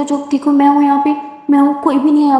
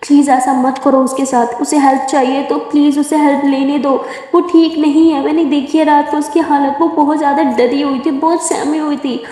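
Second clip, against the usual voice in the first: emotional pleading; 3 semitones higher than usual. Acted. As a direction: plead hard with the angry mother. Simran arguing with Durga's mom.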